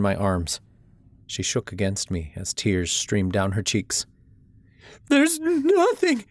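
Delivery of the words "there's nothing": very high, slow broken sob; sobbing. A narrator's reading. Mother kneeling by her burned house, sobbing. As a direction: let the words break in tears. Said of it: "she sobbed".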